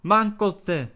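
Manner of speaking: high, loud exclamation; startled cry. A narrator's reading. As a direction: cry out in alarm. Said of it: "vykřikla ještě jednou Anče".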